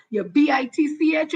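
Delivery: spelling out "B I T C H" each letter separately instead of the word; spelled out. Shown as a demonstration. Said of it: "I will spell it this time".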